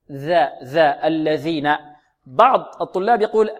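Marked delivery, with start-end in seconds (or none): 0.1-1.7 ذا، ذا with the tongue tip out between the teeth; the الذين ذال voiced between the teeth; ذال said right. Shown as a demonstration. Teacher shows the ذال of الذين to his students. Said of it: "على أطراف الثنايا العليا هكذا".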